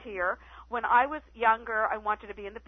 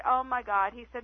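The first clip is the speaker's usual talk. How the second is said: normal talking voice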